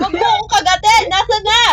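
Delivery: loud panicked yell. Real, not acted. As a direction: shout it in a panic. Bitten, yells at friend. (malakas na sigaw)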